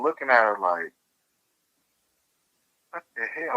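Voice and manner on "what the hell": bewildered. Retold or imitated. Retold bewildered reaction to advice.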